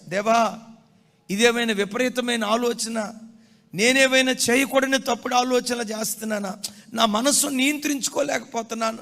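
loud, high desperate prayer; preacher voicing the boy's loud prayer; anguished plea to God. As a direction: cry out to God in distress. Acted cry to God.

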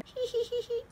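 high, soft giggle; tittering laugh. A narrator's reading. Teacher giggles softly to Peppa's mother.

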